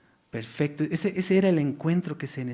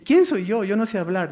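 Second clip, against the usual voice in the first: higher and louder, protesting; loud emphatic objection; 7 semitones higher, 7 dB louder than usual. Acted. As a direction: throw the question out with force. Moses' objection, emphasized retelling.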